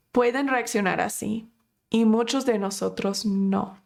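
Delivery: normal talking voice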